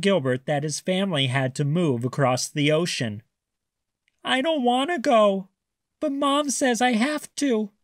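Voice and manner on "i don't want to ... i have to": high, tearful little voice; crying child. A narrator's reading. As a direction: plead like a small upset fish. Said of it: "cried Raymond".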